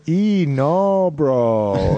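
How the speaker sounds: lightly imitated New Mexico drawl, vowels stretched; a playful hint of a New Mexico accent, long vowels. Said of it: "they have a way of elongating their vowels".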